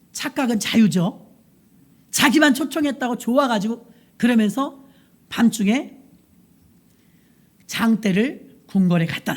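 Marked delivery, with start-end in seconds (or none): none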